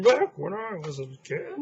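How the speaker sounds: put-on high, crackly old man. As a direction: creak it out like a grandpa. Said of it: "you have to say that in an old man voice".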